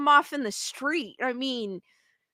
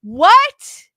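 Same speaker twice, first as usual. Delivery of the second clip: loud, high rising what; can't believe what you read; disbelieving what; 6 semitones higher, 12 dB louder than usual. Real, not acted. Streamer reading Daniel's comment, loud disbelieving what. Disbelief at animal control rule.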